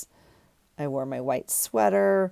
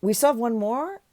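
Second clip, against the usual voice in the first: retold disbelief; 6 semitones higher than usual. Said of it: "that's what I say that comes out of my mouth".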